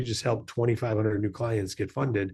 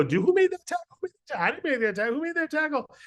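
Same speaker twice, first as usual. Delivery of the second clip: very high, frantic little fly; faster than usual. Acted. Fly asking the mosquito who tackled, in a very high voice. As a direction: squeak the question over and over. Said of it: "the fly says to the mosquito".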